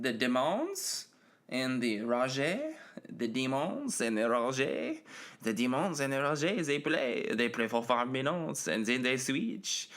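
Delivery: hammed-up French pronunciation; mock French accent. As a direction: put on a silly French accent, lifting the word endings. Mock-French reading of team names.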